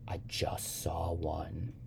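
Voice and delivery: put-on slow, soft, whispery child voice; hushed report. A narrator's reading. Sybil reporting to the young man, hushed. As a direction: whisper it slowly like a small discovery.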